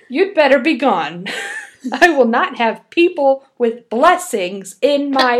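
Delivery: sassy angry witch. Acted. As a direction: scold her out with sass, laughing. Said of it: "You're adding some extra sass".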